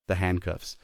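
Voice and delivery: usual speaking voice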